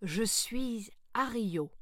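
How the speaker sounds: very slow, drawn-out creature voice; creature introducing itself. Acted. Ario introduces itself to Sarah, slow and stately. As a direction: stretch the words out with slow grandeur.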